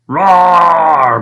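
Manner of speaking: loud roaring bellow; dragon bellow. Acted. The dragon bellows at the battle, loud roar. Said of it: "bellowed the dragon".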